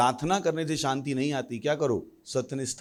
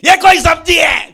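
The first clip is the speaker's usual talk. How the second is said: furious shout; 13 semitones higher, 16 dB louder than usual. Acted. Angry man at dinner acted.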